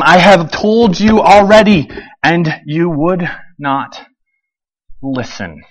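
slow, tired reply fading down; weary reply. Acted. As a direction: let it sink, exasperated. Healed man answering, weary.

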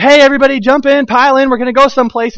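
rally everyone into the car with gusto; calling the kids. Retold or imitated. Dad calling the kids to pile in, loud and high. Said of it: "So I said".

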